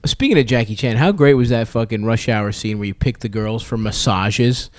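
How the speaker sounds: ordinary delivery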